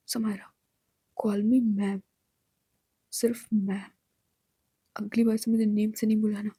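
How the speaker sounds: low, cold boss voice; stern correction. A narrator's reading. Boss to employee who used her first name, stern.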